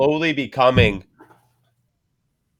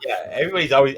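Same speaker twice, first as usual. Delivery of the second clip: ordinary talk, nothing marked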